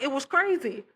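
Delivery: usual speaking voice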